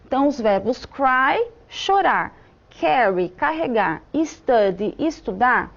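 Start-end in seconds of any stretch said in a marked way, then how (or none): none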